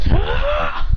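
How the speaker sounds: high-pitched attacking yell; acted high fight cry; mock battle cry. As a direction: yell as if striking. Character attacks in mid-air.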